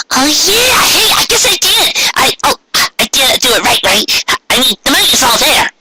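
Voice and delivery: very high bubbly pony voice, quickening at the end; Pinkie Pie. A narrator's reading. Pinkie checking the cash drawer, very high and chirpy. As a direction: squeak it out, suddenly unsure. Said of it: "said Pinkie".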